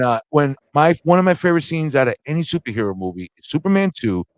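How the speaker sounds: usual speaking voice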